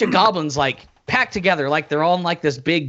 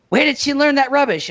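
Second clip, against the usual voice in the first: mock goblin voice; 8 semitones higher than usual. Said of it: "One of the goblins say".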